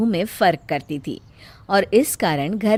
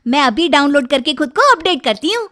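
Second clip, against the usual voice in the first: bright, higher, louder promo voice; cheerful ad read; 6 semitones higher, 6 dB louder than usual. Acted. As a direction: sell the app with delight. Upbeat app ad read.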